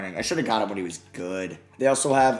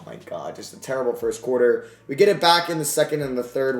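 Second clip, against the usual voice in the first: normal talking voice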